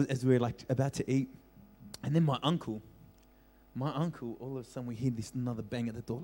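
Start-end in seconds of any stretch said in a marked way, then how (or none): none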